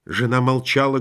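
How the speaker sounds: plain reading voice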